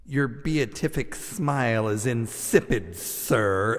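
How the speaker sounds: slow, lofty and precise; withering professor put-down. Retold or imitated. Professor cutting down the front-row student. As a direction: deliver a cutting insult grandly. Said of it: "he looks down at S D and he says".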